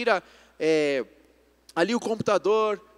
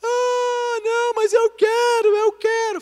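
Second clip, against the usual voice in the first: high, loud imitated tantrum; crying child; 7 dB louder, slower than usual. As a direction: wail it like a toddler refusing to listen. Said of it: "Ela chegou chorando".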